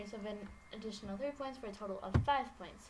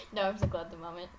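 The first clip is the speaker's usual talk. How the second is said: ordinary talk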